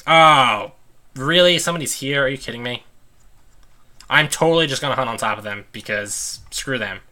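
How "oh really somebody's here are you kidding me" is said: sharp rise of disbelief; exasperated disbelief at a rival player; annoyed surprise. Real, not acted. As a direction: caught off guard, then peeved. Someone took her spot.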